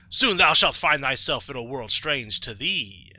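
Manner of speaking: grand, stately prophecy; Arceus, godlike narrator. Acted. Player voicing Arceus foretelling a strange world, grand and stately. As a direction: foretell it like an all-knowing deity. Reads the game's Arceus dialogue aloud.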